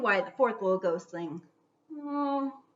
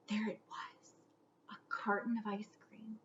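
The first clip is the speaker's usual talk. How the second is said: awed hushed whisper; 12 dB quieter than usual. A narrator's reading. Narrator whispering as the ghost finds ice cream. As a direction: breathe the discovery like treasure.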